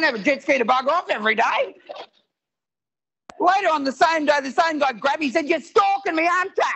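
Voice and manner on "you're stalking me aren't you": high-pitched indignant accusing; acted accusation. Passenger grabs the comedian on the ship, mock accusing. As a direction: accuse him in a raised, high voice.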